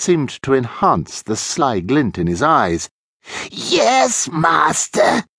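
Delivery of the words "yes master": high, drawn-out henchman voice; put-on servile character, pitched up; obedient servant. A narrator's reading. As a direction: answer your master eagerly. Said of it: "replied Renfield".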